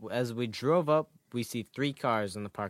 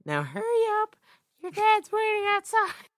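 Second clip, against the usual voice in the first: high mom voice; 5 dB louder than usual. Acted. Mom voiced, shooing kid.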